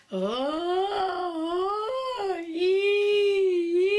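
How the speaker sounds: very high, loud cry-like oh and ee; wailing vocal into laugh. Shown as a demonstration. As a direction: let the sound wail out into laughter. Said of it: "whatever song you want".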